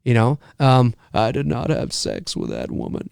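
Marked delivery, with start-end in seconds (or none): 1.1-3.1 Bill Clinton quote. Retold or imitated. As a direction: lower and softer, a throwaway Clinton line. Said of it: "is not an impression of Bill Clinton".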